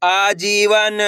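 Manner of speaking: आजीवन in long, separate syllables; blended syllable reading. Shown as a demonstration. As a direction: stretch out आजीवन for learners. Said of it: "तो मिलके बन जाएगा". (आजीवन को खींचकर)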